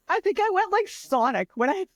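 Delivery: squeaky like Sonic from laughing; voice gone high. Real, not acted. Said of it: "my voice went so high there".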